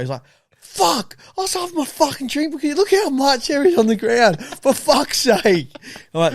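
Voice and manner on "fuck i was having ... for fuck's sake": higher-pitched fed-up swearing; angry grumble. Retold or imitated. Mimicking the mate cursing at his spilled drink, annoyed. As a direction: play the mate sulking about his drink.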